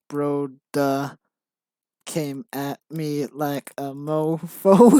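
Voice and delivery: slow mock gangster, drawn-out words; hammed-up gangster, word by word. Writer reading out own embarrassing script, mock gangster. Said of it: "stereotype of how gangsters talk".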